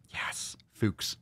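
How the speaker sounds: Fuchs whispered as an aside; soft voiceless aside; whispered name. Shown as a demonstration. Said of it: "say it both ways".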